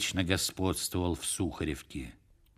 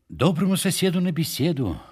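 high-pitched, singsong invitation; hearty tavern greeting; 8 semitones higher than usual. A narrator's reading. Фомушка comes up to the major's table, hearty greeting. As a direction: greet a drinking neighbour in a higher voice. Фомушка подходит к столу, зазывая майора.

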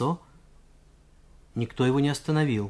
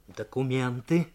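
ordinary delivery

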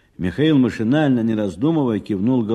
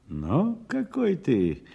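gasping admiration; 6 semitones higher, slower than usual. Acted. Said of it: "Варвара ахнула".

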